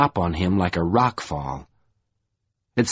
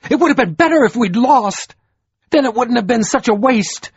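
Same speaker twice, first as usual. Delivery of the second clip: much higher and louder, raging near tears; fury close to weeping; 14 semitones higher, 6 dB louder than usual. A narrator's reading. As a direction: let the fury break almost into a sob. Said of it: "Troy's fury rose like weeping".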